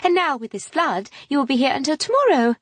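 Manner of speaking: high, pushy society chatter; gushing lady. A narrator's reading. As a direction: insist sweetly but relentlessly. Harriet voiced by narrator.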